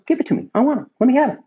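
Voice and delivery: high, eager demanding impression; imitating Marty eager. Director wanting the script, high eager impression.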